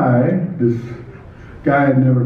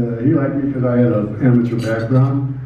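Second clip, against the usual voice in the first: ordinary talk, nothing marked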